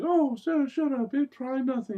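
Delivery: very high put-on girly squeal; mock bashful girl, high-pitched; embarrassed girl. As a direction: brush off the teasing in a high voice. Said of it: "And she's like".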